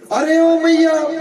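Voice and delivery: drawn-out high loud call. Acted. Angry gopi calls out to Maiya at the door, loud and slow. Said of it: "मैया को आवाजें लगा रही".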